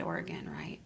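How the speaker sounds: a sudden near-whisper on the last words; drops to a whisper as if sharing a secret; hushed aside. Retold or imitated. Friend adds the place in a hushed aside.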